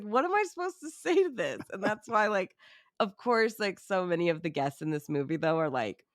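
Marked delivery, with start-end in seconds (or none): 0.0-1.6 high, baffled disbelief; incredulous. Retold or imitated. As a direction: ask it like you truly can't believe it. Guests' awkward reaction to confession.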